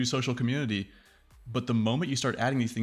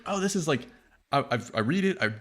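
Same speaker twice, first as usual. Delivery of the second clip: mock pleased; 6 semitones higher than usual. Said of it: "you're like".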